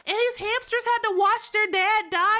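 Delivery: loud, high-pitched protest; distressed and loud, stretching the last word; upset outcry. Real, not acted. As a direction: wail in outrage at something too sad. Distressed outcry at sad game scene.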